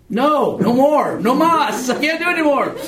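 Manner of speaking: high, loud, laughing mock despair; hammed-up cry of I give up; overwhelmed plea. Teacher mocks himself on the plane, high laughing plea. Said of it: "I'm sitting there just going".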